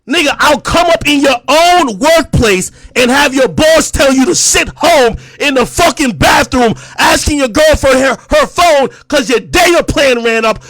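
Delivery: loud, high-pitched dressing-down; angry tirade. Real, not acted. Streamer berating a podcast rival, angry tirade. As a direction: tear into your rival at full volume.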